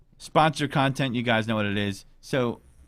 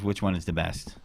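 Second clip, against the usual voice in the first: ordinary talk, nothing marked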